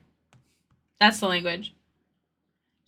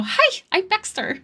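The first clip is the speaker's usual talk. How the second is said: Baxter's greeting pitched higher in her accent; 9 semitones higher than usual. Shown as a demonstration. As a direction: slip into Baxter's accent for the intro. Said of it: "if I, like, lose her accent, I go".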